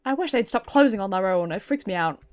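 ordinary talk, nothing marked

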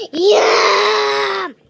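a shrieking happy yum; excited yum. Acted. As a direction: burst out with delight. Kid savoring treats on camera.

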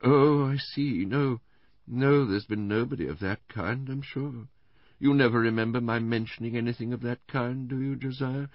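high-voiced invalid wife; Missus Bland, plaintive. A narrator's reading. Invalid wife checking her memory with her husband, plaintive. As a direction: answer in a high, delicate, plaintive tone.

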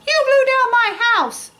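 high angry piggy shout. Acted. Angry piggy yelling at the wolf. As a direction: yell it high like an angry piglet. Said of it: "heard someone shout".

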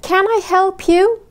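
"Can I help you?" slow and high, voice rising at the end on you; rising question tune. Shown as a demonstration. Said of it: "الصوت في الآخر مرتفع". (نغمة صاعدة في آخر السؤال)

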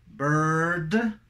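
"Bird" rising, with a hard final d; contrast drill. Shown as a demonstration. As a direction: lift "Bird" and close on d. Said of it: "esa sería la diferencia". ("Bird" ascendente, con D final)

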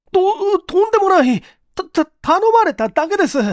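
very high, loud stuttering plea; desperate young man pleading innocence; high panicked stutter; terrified stammer. Acted. Okamoto stammers in terror.

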